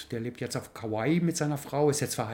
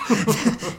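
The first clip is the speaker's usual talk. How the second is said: high bright laugh; 6 semitones higher, 4 dB louder than usual. Real, not acted. Laughing while retelling Mike's reaction. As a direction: burst out laughing.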